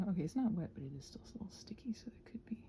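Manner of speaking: normal talking voice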